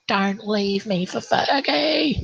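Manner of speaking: high, slow, whiny plea; hammed-up clingy drunk wife; mock drunk wife. Wife begging him not to leave, mock whine. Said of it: "she's like".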